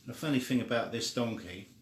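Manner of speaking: plain reading voice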